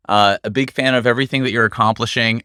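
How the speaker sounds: normal talking voice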